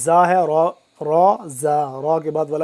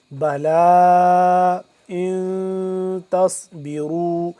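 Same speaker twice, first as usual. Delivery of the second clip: slow chanted tajweed recitation; Quran recitation; 4 dB louder, slower than usual. Shown as a demonstration. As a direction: recite it slowly, drawing out بلا. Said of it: "teacher recites phrase as model".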